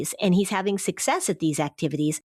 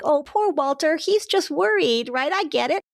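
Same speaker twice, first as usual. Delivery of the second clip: put-on sweet kid; 8 semitones higher than usual. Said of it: "kids that are so sweet".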